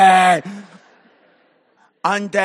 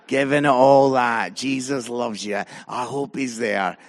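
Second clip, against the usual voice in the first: very low monotone grumble, swelling in bursts; imitated reproachful son; 9 semitones lower, 5 dB louder than usual. Son to dad after he shouted at ref, low droning reproach. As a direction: play a son wearily telling off Dad.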